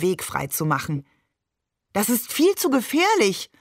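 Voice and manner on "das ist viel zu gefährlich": höher und lauter, besorgte Mutter; besorgt rufend. A narrator's reading. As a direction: ruft erschrocken dagegen an. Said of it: "rief Maman auch schon". (Mutter ruft besorgt dem Vater nach, höher und lauter)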